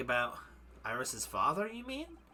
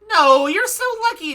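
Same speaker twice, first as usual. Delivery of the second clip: excited; 13 semitones higher, 12 dB louder than usual. Acted. Iris excited over balloon.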